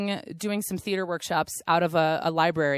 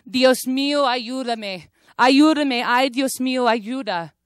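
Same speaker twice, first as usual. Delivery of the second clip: higher, louder anguished Spanish plea for help; crying out; 6 semitones higher, 5 dB louder than usual. Retold or imitated. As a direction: cry out to God in panic. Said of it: "And you cried".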